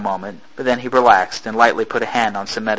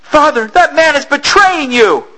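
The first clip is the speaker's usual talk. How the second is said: put-on high, loud young girl's cry; a frightened daughter crying out; girl warning her father; alarmed girl; 5 dB louder than usual.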